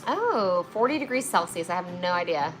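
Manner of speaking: ordinary delivery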